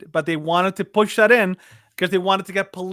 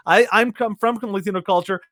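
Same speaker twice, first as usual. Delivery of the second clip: ordinary talk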